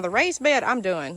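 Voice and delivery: normal talking voice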